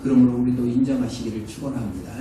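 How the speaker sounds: usual speaking voice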